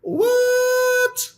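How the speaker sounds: very high, disbelieving What? What?; a high incredulous gasp of what; shocked what. Real, not acted. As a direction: squeal it out in disbelief. Incredulous reaction to story.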